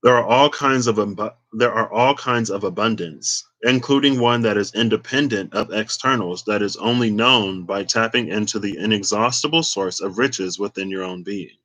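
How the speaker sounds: ordinary talk, nothing marked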